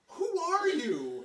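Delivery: high, loud, drawn-out incredulity; mock astonishment. Producer looking at his insect photos, imitated loud amazement. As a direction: imitate someone utterly dumbfounded, stretching each word.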